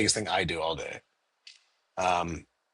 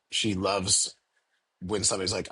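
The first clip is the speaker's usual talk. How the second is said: ordinary talk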